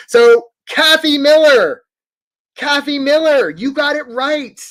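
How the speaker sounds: loud, high, drawn-out name call; excited announcement. Real, not acted. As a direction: sing out the winner's name. Calling out the correct answer.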